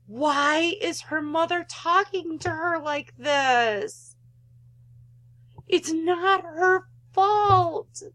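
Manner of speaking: slowed, pleading protest, voice rising; dismayed slow outburst at the mother; upset outburst. Real, not acted. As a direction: push back at the screen in dismay. Player reacts upset to mother.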